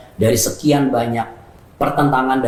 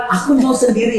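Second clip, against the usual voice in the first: high, louder cry of wanting to be alone; mock shout; 11 semitones higher, 3 dB louder than usual. Host voicing someone who says they want to be alone, shouted high. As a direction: fling it out like a hurt person pushing others away.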